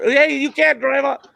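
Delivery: hammed-up cop; scolding cop. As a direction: play the cop telling him off. Cop scolding in retold story.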